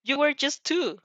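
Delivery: usual speaking voice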